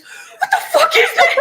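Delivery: very high, laughing scared shriek; terrified yelp. Retold or imitated. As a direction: shriek at the glowing eyes. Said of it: "He goes".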